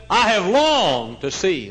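loud, slow, tearful outburst; joyful weeping. Retold or imitated. Visitor weeping with joy to the preacher, loud and slow. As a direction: cry it out with joy.

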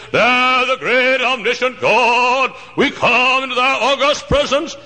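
drawn-out holy tone mocking formal preachers; pompous preacher prayer. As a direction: ham up a stuffy pulpit prayer. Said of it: "you know why they talk like that?".

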